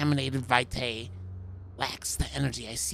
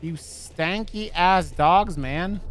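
louder, slow, every word stretched out; loud drawn-out insult; 4 semitones higher, 6 dB louder, much slower than usual. Pretend. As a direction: bellow it at the stinky dogs.

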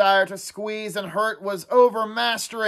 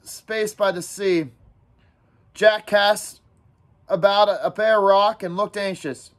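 ordinary delivery, nothing marked

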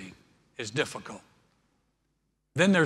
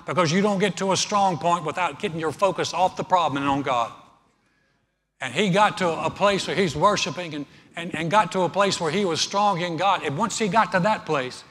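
raised, insistent preacher drive; preacher pushing the congregation toward bold prayer; preaching at full force; 3 semitones higher, 5 dB louder than usual. Acted. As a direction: press the point home from the pulpit. Preaching climax.

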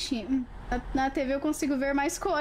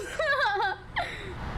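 high laugh; 6 semitones higher than usual. Real, not acted. Laughing at own mock Spanish act. Big laugh after bit.

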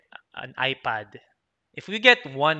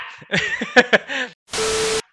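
high laughter; 9 semitones higher, 7 dB louder than usual. Real, not acted. Laughing after telling it.

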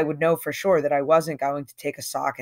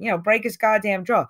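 ordinary delivery

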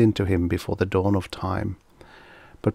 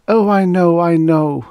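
high, loud, anguished admission; sorrowful; 9 semitones higher, 7 dB louder than usual. A narrator's reading. She admits it to her companion, sorrowful. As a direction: wail it in a high voice. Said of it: "twisted by my sorrow".

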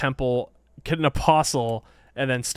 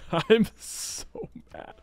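usual speaking voice

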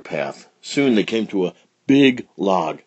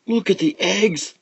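put-on squeaky excited child; high little alligator; 12 semitones higher than usual.

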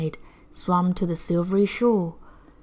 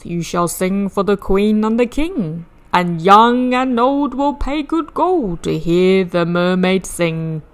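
Sam Sly tempting; 6 dB louder than usual. Acted. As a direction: sell the dream like a smooth circus boss. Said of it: "He said".

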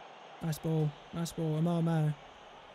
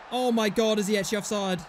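normal talking voice

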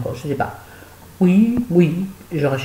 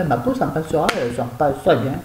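ordinary talk, nothing marked

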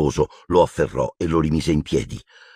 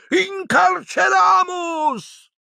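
very high, slow shouted incantation; shouted spell; much slower than usual. Acted. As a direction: fire the spell with a scream.

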